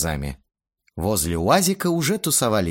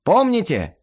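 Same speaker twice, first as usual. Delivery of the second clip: higher, louder commanding director; brisk director voice; 6 semitones higher, 4 dB louder than usual. A narrator's reading. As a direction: cut him off, call places. Stas cuts him off.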